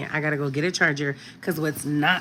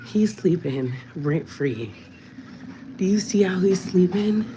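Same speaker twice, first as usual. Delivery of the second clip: whispering. Real, not acted. Whispering in baby's room.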